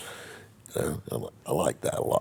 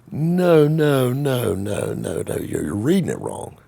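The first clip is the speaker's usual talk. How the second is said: flustered denial; 9 semitones higher, slower than usual. Retold or imitated. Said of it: "And I'm like".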